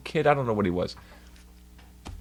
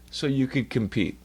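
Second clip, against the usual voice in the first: ordinary talk, nothing marked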